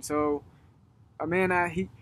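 ordinary talk, nothing marked

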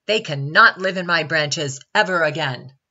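loud, emphatic refusal; bellowing. A narrator's reading. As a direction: bellow it as a final word. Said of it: "bellowed Baby Baobab".

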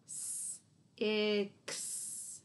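six split into S and ix; segmenting sounds. Shown as a demonstration. Teacher segmenting six for kids. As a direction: stretch six apart as S then ix. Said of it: "X sound at the end".

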